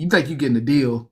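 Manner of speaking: normal talking voice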